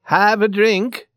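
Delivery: loud, high, drawn-out invitation; big hailing Swede voice; crying out. A narrator's reading. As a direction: call a drink across the table, slowly. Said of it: "the Swede cried to the men at the table".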